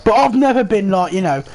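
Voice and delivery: normal talking voice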